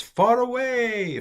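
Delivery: high, stretched-out words; far-off calling. Shown as a demonstration. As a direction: draw it out to show the distance. Acts out distance from accent.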